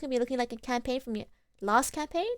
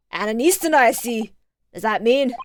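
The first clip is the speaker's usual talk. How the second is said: put-on loud, clumsy Irish accent; bad Irish accent; 6 dB louder than usual. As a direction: belt it out in a badly faked Irish brogue. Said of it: "does he have an Irish accent".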